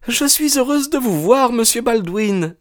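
very high, anxious politeness; high-pitched hostess covering her panic; flustered young woman. Acted. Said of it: "Elle était plus que confuse, affolée". (jeune femme affolée, voix très aiguë)

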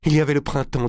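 hâtif, à bout de souffle; voix pressée, haletante. Acted. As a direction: parler vite, le souffle court. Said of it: "d'une voix pressée, haletante". (haletant et pressé)

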